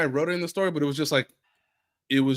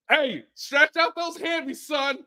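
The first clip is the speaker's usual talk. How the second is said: mock coach call; 7 semitones higher than usual. Mock coach calling to player.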